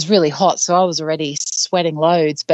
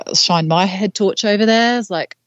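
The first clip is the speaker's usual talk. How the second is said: ordinary talk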